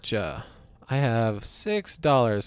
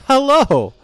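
high, loud cartoon hello; squeaky hello; 10 semitones higher, 9 dB louder than usual. Gamer keeps greeting the monster, squeaky and high.

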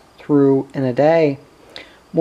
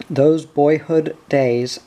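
usual speaking voice

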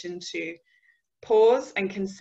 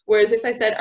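ordinary talk

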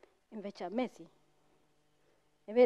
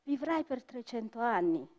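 measured storyteller's voice with music under it; story narration; 2 semitones higher, 10 dB louder than usual. Acted. Storyteller telling an audience the coffee legend, measured and solemn.

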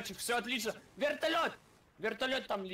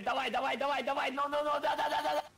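excited shouting; 5 semitones higher, 3 dB louder, faster than usual. Real, not acted. Gunner urging plane kill.

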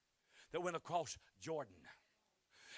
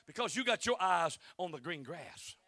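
ordinary talk, nothing marked

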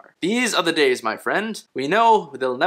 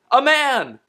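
grand proclamation; 10 semitones higher, 5 dB louder than usual. Pretend. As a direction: exclaim it high and loud. Mock-grand reading of pamphlet.

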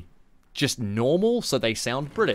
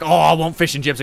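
lightly voiced Aussie oldie, a little higher and louder; slightly hammed-up old Aussie punter; old customer; 4 semitones higher, 6 dB louder than usual. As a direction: imitate an elderly Aussie customer ordering. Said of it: "All the oldies came in".

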